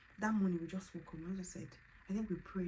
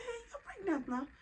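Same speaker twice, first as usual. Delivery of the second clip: excited exclaim; 13 semitones higher than usual. Retold or imitated. Staff excited.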